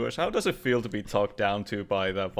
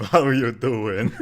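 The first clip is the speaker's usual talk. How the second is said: laughing greeting; 5 dB louder, slower than usual. Real, not acted.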